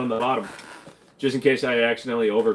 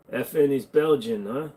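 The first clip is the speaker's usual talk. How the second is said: normal talking voice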